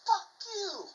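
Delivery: very high-pitched shouted comeback; acted-out furious refusal; retold defiant yell. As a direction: yell it back at the captain, voice shooting up. Acted second retort to yelling captain.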